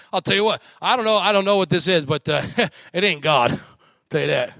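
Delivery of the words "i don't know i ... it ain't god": usual speaking voice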